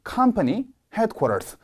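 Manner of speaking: company and headquarters both hit hard; slow, lean on each noun; both nouns stressed. Shown as a demonstration. Teacher modeling a compound noun with both words stressed. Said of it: "둘 다 세게 읽어주신다".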